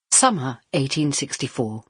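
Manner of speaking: ordinary delivery, nothing marked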